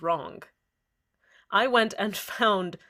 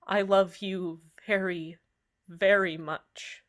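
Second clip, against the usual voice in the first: ordinary talk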